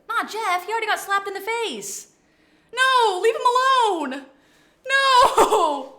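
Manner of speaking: playful high protest; goofy. Real, not acted. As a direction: squeal no in mock protest. Protesting chat, laughing.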